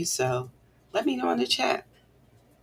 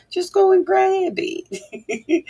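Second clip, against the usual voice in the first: playful sing-song; 14 semitones higher, much slower than usual. Pretend. Playful urging to viewers.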